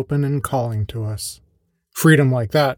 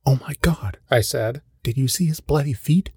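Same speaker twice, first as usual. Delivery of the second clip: hushed, shocked whisper. Retold or imitated. Narrator to brother outside the store, shocked whisper. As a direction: lean in and whisper it, stunned.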